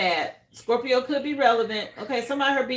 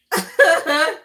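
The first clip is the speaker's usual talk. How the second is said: loud laugh; 5 semitones higher, 7 dB louder than usual. Real, not acted. Laughing at caller.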